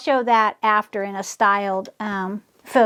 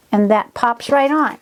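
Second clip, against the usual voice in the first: ordinary talk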